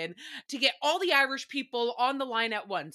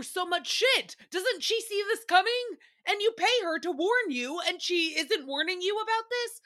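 incredulous rising; 8 semitones higher than usual. Real, not acted. Baffled rant about the psychic.

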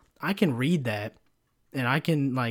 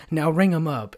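a raised, bossy "Now ring 'em up"; mock demand; 4 semitones higher, 5 dB louder than usual. Pushy customer ordering the cashier, loud mock demand. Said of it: "and then goes".

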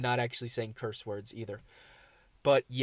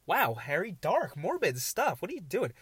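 higher, swooping mock dismay; mock self-scolding; 6 semitones higher, 4 dB louder than usual. Host checking himself for morbid stuff, mock scolding. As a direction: playfully tell yourself off, pitch leaping up.

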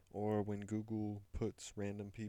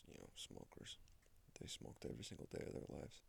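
whispered aside; 7 semitones lower, 10 dB quieter than usual. Real, not acted. Whispered aside about parents.

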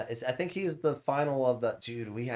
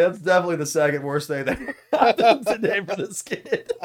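loud, high, helpless laughter in the words; laughing while talking; 7 semitones higher, 9 dB louder than usual. Real, not acted. Streamer delivering dark punchline to friends, real laughter throughout. Cracking up at own dark story.

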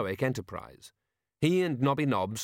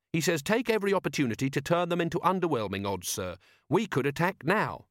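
earnest subordinate, higher voice; keen Carrot; 6 semitones higher than usual. Acted. Carrot quoting Tacticus to Vimes, keen and earnest. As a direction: propose it eagerly to your commander.

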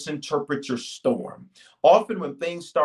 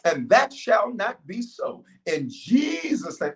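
slow, earnest prayer voice; preacher leading a congregation in prayer; 4 semitones higher than usual. Acted. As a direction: pray it over the people, drawn out. Leads a prayer.

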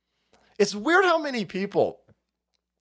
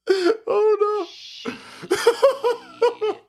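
very high laughing wail; high, cracked-up dismay; real helpless laughter; 14 semitones higher than usual. Real, not acted. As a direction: squeal it through laughter. Laughs at being caught out.

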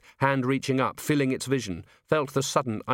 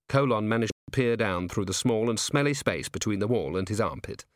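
ordinary delivery, nothing marked

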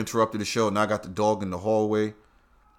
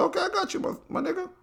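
very high, soft mock voice; squeaky quoted reply; 6 dB quieter, faster than usual. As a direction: squeak it out, sheepish. His own quoted reply.

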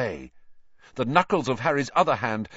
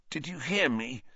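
soft, feeble question; weak, injured boy; 3 semitones higher, 6 dB quieter than usual. A narrator's reading. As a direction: ask it with little strength left. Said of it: "Ron said weakly".

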